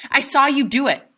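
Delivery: high, loud, firm accusation. Acted. Boy accusing his father to his face, loud.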